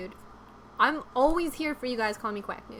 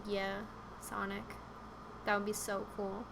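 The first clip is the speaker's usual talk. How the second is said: dreamy reply; 3 semitones lower, 10 dB quieter than usual. Pretend. Her imagined reply to Sonic.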